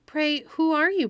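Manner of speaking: high, loud wonder; excited exclaim. A narrator's reading. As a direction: burst out in delighted wonder. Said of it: "exclaimed Pandora".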